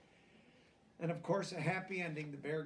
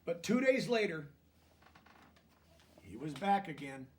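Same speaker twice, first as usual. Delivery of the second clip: gentle storybook reading, a touch higher; lively picture-book narration; reading to children; 5 semitones higher, 7 dB louder, slower than usual. A narrator's reading. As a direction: read it like a bedtime story to kids.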